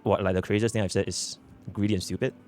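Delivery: ordinary talk, nothing marked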